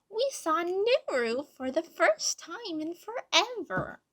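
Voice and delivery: high, loud, excited little voice. A narrator's reading. Tiki interrupting with news of seeing Nuru, excitedly. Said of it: "she said excitedly".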